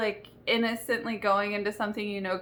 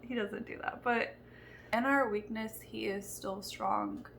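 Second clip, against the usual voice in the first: ordinary talk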